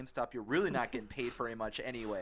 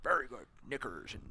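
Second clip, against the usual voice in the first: put-on old rich guy voice; 2 semitones lower, 6 dB quieter than usual. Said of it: "that's old rich guy sound".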